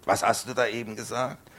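put-on high, loud enraged old farmer; outraged bellow; furious shout. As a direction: roar the question at him. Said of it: "angepoltert und schrie".

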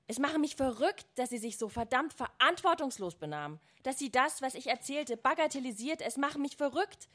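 mounting outburst in a narrator's voice; angry shouting, read aloud. A narrator's reading. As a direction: read it as a tirade at Mother. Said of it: "ich schrie sie an, schrie".

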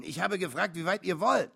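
annoyed. A narrator's reading. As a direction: repeat the question with impatience. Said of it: "Das verärgerte ihn".

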